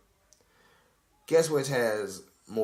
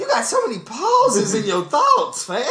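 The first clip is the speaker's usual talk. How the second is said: high, loud, cracking up; incredulous, laughing squeal; laughing disbelief; 6 dB louder than usual. Real, not acted. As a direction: heckle through laughter. Laughing mockery of paused delivery.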